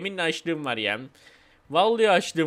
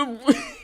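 higher-pitched burst of laughter; laughing; 5 semitones higher than usual. Real, not acted. Laughs at Zuzu in game.

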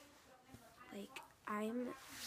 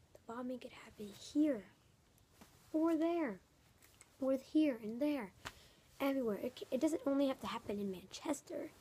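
louder and higher, building alarm over each place; urgent raised voice; 4 semitones higher, 9 dB louder than usual. Real, not acted. Kid vlogger to viewers, raised and urgent. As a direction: call it out like it could happen anywhere. Upset kid listing possible bomb targets.

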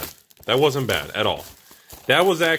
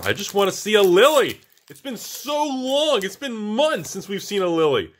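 whiny longing; 9 semitones higher, 3 dB louder than usual. Real, not acted. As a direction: stretch out the yearning. Longing for a Lily card.

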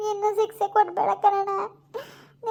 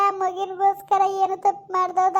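ordinary delivery

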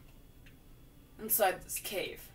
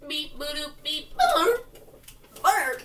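robot beeps; 5 semitones higher, 7 dB louder, much slower than usual. Pretend. Robot noises mimicking Regi.

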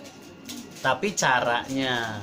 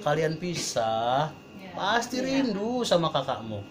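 mother's admonition; 4 dB quieter, slower than usual. Retold or imitated. Mother telling fighting siblings they will miss each other, admonishing. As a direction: imitate a mother slowly warning her squabbling children. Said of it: "Ibu saya itu bilang".

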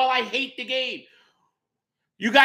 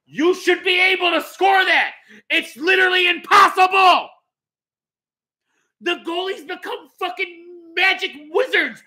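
angry rant; 6 semitones higher than usual. Real, not acted. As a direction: yell it out in outrage. Ranting about goalies.

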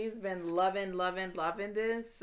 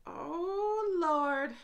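swooning cry; 8 semitones higher than usual. Real, not acted. Overcome by the scent.

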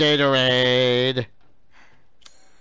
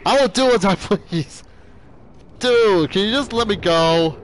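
panicked pleading while fleeing; being chased; 6 semitones higher than usual. Real, not acted.

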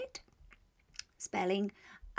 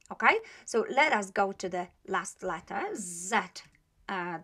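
ordinary talk, nothing marked

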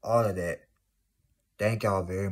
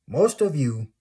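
higher, louder punch on most of you; raised emphatic call to viewers; emphatic loud repeat; 6 semitones higher, 8 dB louder than usual. Real, not acted. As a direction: hit Most of you hard the second time.

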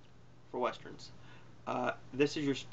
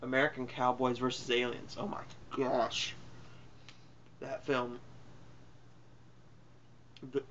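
usual speaking voice

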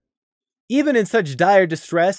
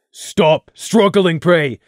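slow, louder, firm command; firm loud order; 6 dB louder, much slower than usual. Acted. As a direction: hold him still with your voice. Crimson holding thrashing Prey.

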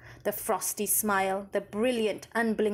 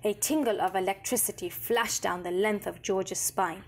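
ordinary delivery

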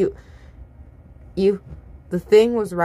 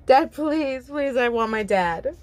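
high, mildly tearful begging; understated crying-out, pitched up at first; desperate sobbed plea; 5 semitones higher, slower than usual. A narrator's reading. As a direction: plead for Dad with a touch of desperation. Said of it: "you cried out desperately, voice breaking".

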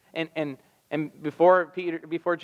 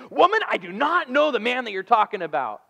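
a higher, louder mock outburst of denial; raging denial; 9 semitones higher, 4 dB louder than usual. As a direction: snap back at her, a retold rage. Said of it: "he just explodes in this rage".

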